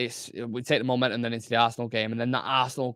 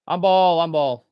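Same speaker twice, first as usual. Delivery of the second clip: loud, raised appeal for handball; calling out; 3 semitones higher, 7 dB louder, slower than usual. Real, not acted. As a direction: call for the foul, easing on the repeat. Calling a foul during match.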